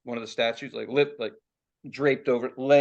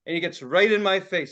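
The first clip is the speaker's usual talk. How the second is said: ordinary talk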